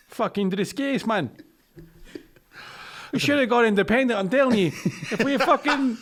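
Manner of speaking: angry Scottish ranter, half laughing; Scottish accent. Pretend. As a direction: lay it on thick, a fed-up Scottish lad. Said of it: "how good you are at accents".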